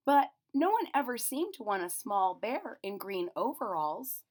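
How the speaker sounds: put-on lively children's story reading; story narration. A narrator's reading. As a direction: read it to the kids with wonder.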